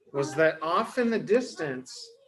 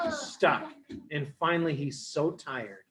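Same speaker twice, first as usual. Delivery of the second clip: usual speaking voice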